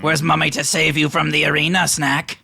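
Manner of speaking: high, mocking kobold voice; taunting kobold. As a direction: jeer at him like a nasty creature.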